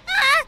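startled yelp. Real, not acted.

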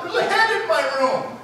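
put-on very high little-girl voice; imitated little daughter. Four-year-old telling dad about a head, imitated child voice. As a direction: mimic a small child reporting a night-time scare. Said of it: "my daughter comes into my room and goes".

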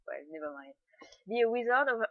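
normal talking voice

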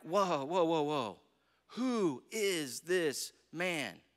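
low, slow dawning amazement; stunned awe. Acted. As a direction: draw it out slowly, as if stunned. Disciples realizing, acted in awe.

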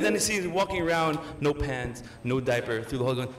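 ordinary talk, nothing marked

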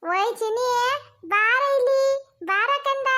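put-on high, slow sing-song baby call. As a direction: coax the little one over, drawn out and high. Grandmother calls the baby over.

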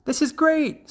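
very high thrilled little voice; delighted frog child. A narrator's reading. Young frog dangling from the flying hawk, high thrill.

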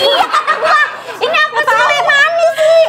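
high, laughing protest; can't hold back the giggles; laughing at the Sule mix-up. Real, not acted. Laughing over mixed-up Sule.

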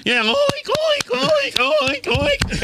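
high squeaky oink oink, mocking; mock pig oinks. Mocking the clapping audience with high pig oinks. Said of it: "mocking the audience as pigs".

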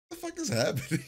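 high, squeaky what-the-hell; bewildered shock at the scene; a jump way up in pitch, baffled; baffled disbelief. Retold or imitated.

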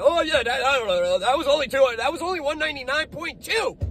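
high, whiny mock of nitpicking haters; hammed-up mocking whine. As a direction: sneer it like a petty critic.

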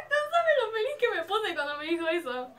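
high gasping excitement; thrilled. Real, not acted. Fangirl telling viewers her dream made her happy, thrilled. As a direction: gush it out high after a gasp.

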